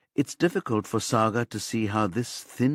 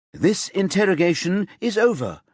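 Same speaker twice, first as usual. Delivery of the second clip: sharp, loud, higher cut-off; said sharply; 10 semitones higher, 4 dB louder than usual. A narrator's reading. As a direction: shut the interrogation down, sharply. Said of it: "Singina says sharply".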